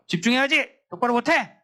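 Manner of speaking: high-pitched teacher's warning; scolding teacher. Acted. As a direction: act out a teacher scolding the class. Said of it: "교사가 학생의 경고를".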